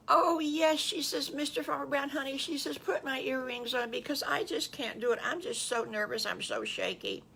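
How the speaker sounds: high, breathless flutter of an excited farm wife; nervous excitement. Acted. Missus Farmer Brown begging her husband to help with earrings, high and flustered. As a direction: flutter with nerves, eager and shaky. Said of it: "She was so excited".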